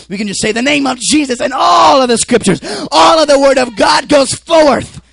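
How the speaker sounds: loud, high-pitched sermon shout; full-force preaching. Acted. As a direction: preach it at the top of your voice.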